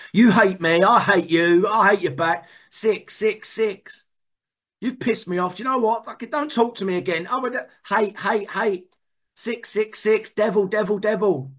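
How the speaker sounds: high, hammed-up hateful rant; mock spite. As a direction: play your worst self, spitting hate. Acting out character defects state.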